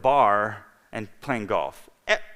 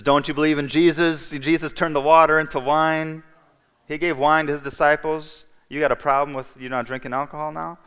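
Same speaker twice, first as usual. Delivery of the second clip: higher and louder, scoffing challenge; mocking priest; 6 semitones higher, 7 dB louder than usual. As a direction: needle him like an incredulous cleric. Priest mocks him over wine.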